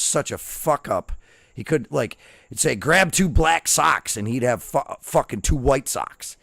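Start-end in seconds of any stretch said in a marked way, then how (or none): 2.8-4.2 barked order. Retold or imitated. Drill instruction barked.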